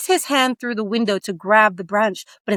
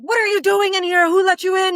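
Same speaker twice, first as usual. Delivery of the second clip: angry demand; 10 semitones higher than usual. Acted. Heathcliff bursting in.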